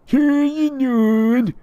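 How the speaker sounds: loud, high squealed call; hammed-up high calling out; Tyler. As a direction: yell a greeting in a squeaky character voice. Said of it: "His name is Tyler".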